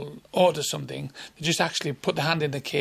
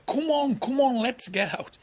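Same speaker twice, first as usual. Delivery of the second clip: urgent tour manager; 7 semitones higher than usual. Retold or imitated. Tour manager urging escape.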